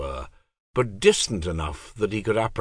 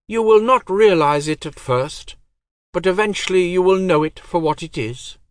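high, cold divine voice; goddess voice; 11 semitones higher, 6 dB louder than usual. Acted. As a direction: speak as an aloof goddess.

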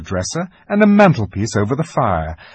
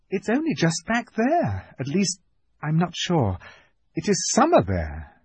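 lightly voiced little girl, higher; Lucy, unsure; 7 semitones higher, 4 dB quieter than usual. A narrator's reading. Lucy explains the wardrobe to fawn, hesitant girl's voice.

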